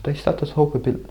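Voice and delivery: airy high coo meant to sound caring; fake caring guru voice. Shown as a demonstration. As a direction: demo a falsely sweet spiritual teacher. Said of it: "they're more caring".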